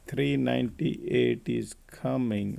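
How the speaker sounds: slow, stretched-out price call; drawn-out repeat. Real, not acted. Trader telling viewers three ninety-eight is coming, slow and drawn out.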